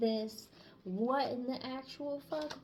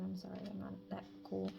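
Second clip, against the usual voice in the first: ordinary talk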